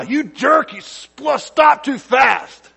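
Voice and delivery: high, loud angry yell; yell at the guy. Pretend. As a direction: blast him with a furious shout. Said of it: "If you yell at the guy".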